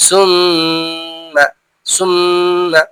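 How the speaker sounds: very slow "সুম্মা" with the ম hummed long; doubled mim. Shown as a demonstration. Teacher repeating tashdid on mim, long held consonant. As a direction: hold the ম of "সুম্মা" before releasing it. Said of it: "মিমের উপর তাসদিদ".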